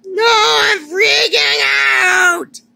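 high, drawn-out panicked scream; shrieking, loud and slow; freaking out. Acted. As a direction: scream it out in a full meltdown. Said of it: "cover your ears".

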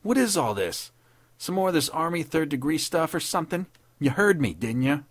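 high, defiant challenge; daring retaliation. A narrator's reading. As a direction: push back indignantly at the officer. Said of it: "he retaliated daringly".

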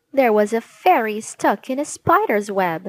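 ordinary delivery, nothing marked

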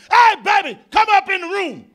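a man yelling for sex; crude come-on. Retold or imitated. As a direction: bark it like a caveman. Crude pickup line.

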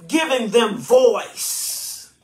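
giving them voice drawn out slowly, each word weighted; slow, deliberate giving them voice; slow emphasis. Shown as a demonstration. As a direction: stretch out giving them voice to drive it home. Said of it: "I am".

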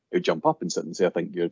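usual speaking voice

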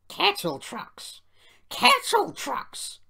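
slow, high, loud offended coaches, building; drawn-out squawk of offence; indignant grumbling. A narrator's reading. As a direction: echo the insult in rising outrage. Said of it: "grumbled the coaches".

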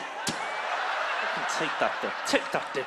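low, very slow, half-breathed filth; hammed-up dirty talk in bed; mock growled dirty talk. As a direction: drag it out low like a crude lover. Acting out crude bedroom talk.

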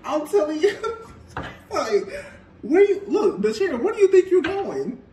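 high, loud laughing outburst; cracking up mid-sentence. Real, not acted. The reader cracking up at her own reading, high and loud.

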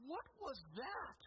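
slow, high-pitched incredulous question; acted-out bewilderment; stunned disbelief. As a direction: ask it like a baffled husband, drawn out. Stunned husband questions his wife.

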